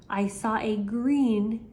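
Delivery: ordinary delivery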